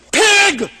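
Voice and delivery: high, loud mock yell; yelled insult. Woman driver warning a man on the road, mock yell. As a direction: holler it like a driver out the window. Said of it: "she sticks right out the window".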